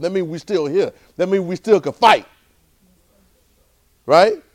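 high, loud, rousing sermon; impassioned preaching. Real, not acted. Preacher urges the congregation on.

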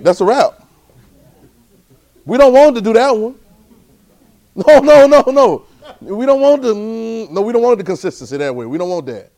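very high, loud preacher's cries; full-force preaching. Real, not acted. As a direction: cry out the refusal to the congregation.

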